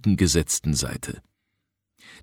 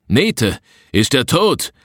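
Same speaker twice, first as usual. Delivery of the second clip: higher-pitched call across a clearing; 5 semitones higher, 4 dB louder, slower than usual. A narrator's reading. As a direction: call the question out to someone far off. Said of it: "rief Anda ... über die Lichtung hinüber". (über die Lichtung rufend)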